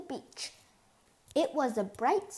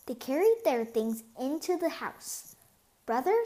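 ordinary delivery

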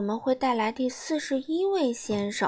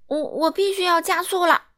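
higher, louder, stammering rush; flustered call; hurried shout; 8 semitones higher, 6 dB louder than usual. Acted. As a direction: dash off in a hurry. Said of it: "他喊道".

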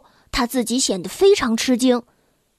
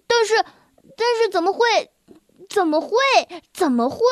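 stammering; 6 semitones higher than usual. Acted. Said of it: "我结结巴巴地说".

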